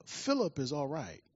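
soft, understated reassurance; quiet, unruffled motherly news; calm mother. Retold or imitated. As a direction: break it gently and quietly. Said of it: "said very calmly".

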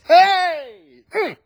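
high, loud burst of joy; a sudden happy holler; excited shout. Real, not acted. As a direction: shout it out like you want to run. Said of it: "I feel like running".

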